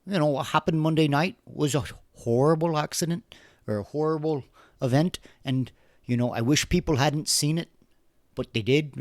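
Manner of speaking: imitating the Irish woman's lilt, not overdone; half-hearted Irish accent impression. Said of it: "the terrible accent".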